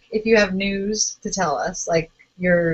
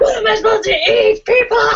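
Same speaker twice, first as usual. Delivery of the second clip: mock hungry rant; 11 semitones higher, 4 dB louder than usual. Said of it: "That's my book voice".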